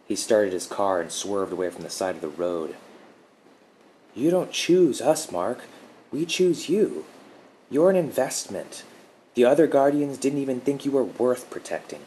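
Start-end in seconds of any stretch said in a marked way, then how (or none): 4.1-12.0 lightly voiced soothing woman, higher; a hint of a calm protector reassuring him; gentle guardian woman. A narrator's reading. Guardian's cool revelation.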